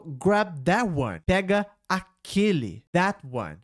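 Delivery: slow, clear demo of the line. Shown as a demonstration. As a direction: spell it out slowly for learners. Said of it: "Então ele falou". (devagar, bem pronunciado)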